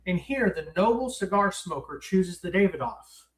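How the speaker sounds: normal talking voice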